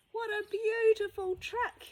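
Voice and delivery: high admiring exclamation; admiring visitors. Acted. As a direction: gush over the truck in a high voice.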